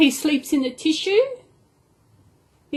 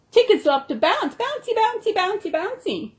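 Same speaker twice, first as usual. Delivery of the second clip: bouncy; 7 semitones higher than usual. Pretend. Tigger loves to bounce.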